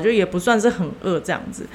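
usual speaking voice

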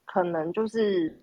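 ordinary delivery

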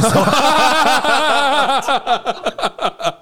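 long falling laugh, high pitched. Real, not acted. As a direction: cackle it out and let it fall. Long laugh after answer.

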